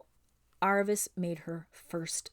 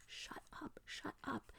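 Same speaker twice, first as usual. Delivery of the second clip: frightened hushed whisper; scared whisper; 6 semitones higher, 18 dB quieter, slower than usual. Retold or imitated. Aravis in danger, scared whisper. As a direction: hush her in fear. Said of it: "it's because she was in danger and she was scared".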